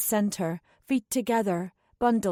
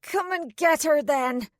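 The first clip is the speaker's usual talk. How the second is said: higher, mocking jeer; taunting; 6 semitones higher than usual. A narrator's reading. Ashlyn taunts the little girl, higher and mocking. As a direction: goad the child. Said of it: "taunted Ashlyn".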